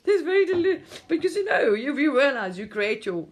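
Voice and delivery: high, loud, talking through laughter; words bubbling with real laughter; giggling while explaining; laughing. Real, not acted. Laughing about delusion.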